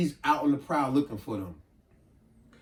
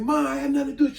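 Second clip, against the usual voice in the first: son pleading; 11 semitones higher, 3 dB louder, much faster than usual. Retold or imitated.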